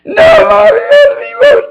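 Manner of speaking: very high, loud mock toddler wail. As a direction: shriek it like a crying toddler. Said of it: "She starts crying".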